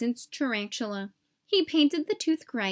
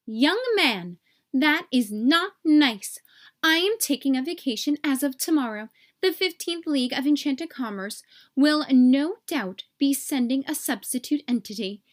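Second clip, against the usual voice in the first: stern fairy note; 3 semitones higher, 3 dB louder than usual. Acted. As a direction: scold him like a prim official.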